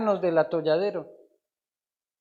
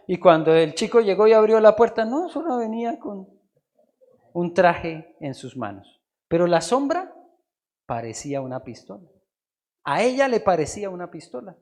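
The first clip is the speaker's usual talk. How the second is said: normal talking voice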